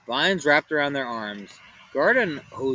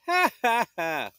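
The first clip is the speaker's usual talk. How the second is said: laughing; 8 semitones higher than usual. Acted. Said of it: "Velma laughed".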